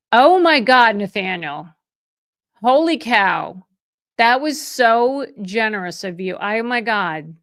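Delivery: loud, delighted outburst at a huge gift; overwhelmed with joy, nearly shouting; thrilled gasp. Real, not acted. Realtor reacting to a generous super chat, overjoyed.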